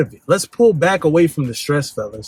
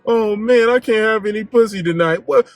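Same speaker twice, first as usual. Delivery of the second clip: mock whiny crybaby; 6 semitones higher than usual. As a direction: whine like a sulking guy who lost his night. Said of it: "The worst thing you can do".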